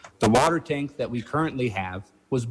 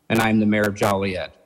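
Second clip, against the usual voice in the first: usual speaking voice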